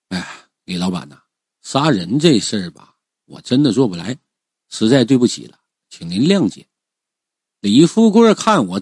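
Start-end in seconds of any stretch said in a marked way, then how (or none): 0.1-6.7 low, softened apologetic voice. Acted. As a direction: lower your voice and plead gently. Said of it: "尽量让自己的声音变得柔和一些". (压低声音，柔和地赔不是)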